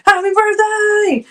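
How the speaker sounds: high, drawn-out celebratory call; birthday cheer. Real, not acted. Streamer wishes a viewer happy birthday, calling out high. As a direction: stretch the birthday wish out high.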